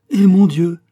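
high, loud, cutting in; sharp interrupting cry. A narrator's reading. The queen interrupts the king with a loud, high cry. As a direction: cut him off with a sharp cry. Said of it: "interrompit la reine".

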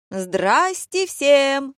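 high, loud, drawn-out hello; yelled greeting. A narrator's reading. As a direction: belt out a showy, stretched greeting. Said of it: "заорала хорошенькая мулатка". (протяжное громкое приветствие высоким голосом)

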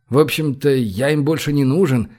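ordinary delivery

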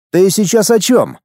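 high-pitched baffled question; taken aback, pitch jumping up; astonished. A narrator's reading. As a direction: ask it in amazement. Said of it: "изумлённо уставился на него".

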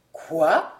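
jolted by what he hears; startled cry. Acted. Old lord cries out in alarm at the albino's words. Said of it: "s'écria monsieur de la Trémelaie".

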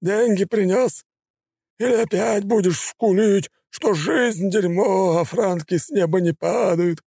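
high, slow, mocking gangster; sneering thug voice. A narrator's reading. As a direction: taunt the debtor lazily.

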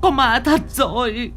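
high, panicked wife cry; shriek of fright. Acted. Wife seeing the ghost on the wall, shrieking. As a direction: cry out in terror. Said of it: "bà Xuân rú lên".

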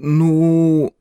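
uncertain, stretched filler; mumbled hesitation. A narrator's reading. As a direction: draw the word out, lost for words. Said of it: "промямлил Тепик".

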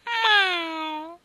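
high, loud mewing meow; sharp high cat cry. Acted. Cat meows.

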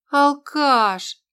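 high, loud exasperated jab. Acted. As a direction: roll your eyes and spit it out. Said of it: "закатила глаза подруга". (с раздражением, закатив глаза)

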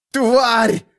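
high, sharp insult yelled out; angry yell. A narrator's reading. Bound lad yells at his captor, furious. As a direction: yell it at her in anger. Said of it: "заорал парень".

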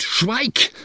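high, sharp narrated shout; angry shout. A narrator's reading. Rival shouting the narrator down, angry shout. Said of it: "schrie er mich abermals an".

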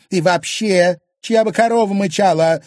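high, loud indignant retort. Acted. Wife retorting to husband in a quarrel, indignant. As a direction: throw it back at him, outraged. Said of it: "возмущённо бросила она".

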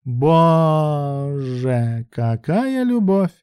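high, very slow mock amazement; drawled. A narrator's reading. Bruk reacts to Ruslan's sacrifice, drawling. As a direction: stretch the vowels out mockingly. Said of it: "протянула Брук".

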